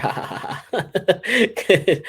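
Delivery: high bursting laughter; real laugh. Real, not acted. Vlogger laughing at the sister comment. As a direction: let the laugh out.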